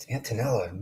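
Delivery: whispered name, no voice; a hushed aside to Antonella. Real, not acted. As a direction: breathe the name softly.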